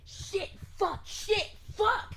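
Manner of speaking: high, loud bursts of swearing; frantic, escalating frustration; frustrated outburst. Acted. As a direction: spit out each curse as a separate yell. Frustrated character swears in panic.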